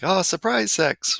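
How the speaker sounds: high, drawn-out mock call; imitated shout-out. Audience shouting out alternate words, imitated in a high voice. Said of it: "we had people just shouting them out like".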